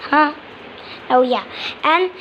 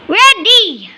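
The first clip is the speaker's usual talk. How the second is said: a bright burst, much higher and louder; loud excited shout; 6 semitones higher, 7 dB louder than usual. Pretend. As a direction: proudly yell it out. Baby bird declares it's ready.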